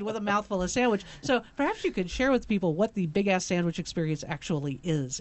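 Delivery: ordinary delivery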